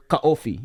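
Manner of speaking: "kaofi" with a break, ka-OH-fee; Samoan pronunciation. Shown as a demonstration. Learner showing what friend taught, "kaofi" with split vowels. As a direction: say "kaofi" as separate vowels, ka, then ofi. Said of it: "you taught me that in Samoan".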